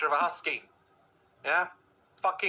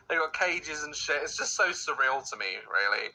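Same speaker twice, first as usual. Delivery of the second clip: ordinary talk, nothing marked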